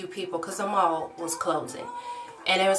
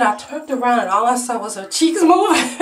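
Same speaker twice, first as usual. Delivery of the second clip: higher, louder, laughing through the quote; laughing retelling; 4 semitones higher, 7 dB louder than usual. Retold or imitated. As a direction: tell it cracking up. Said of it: "she said".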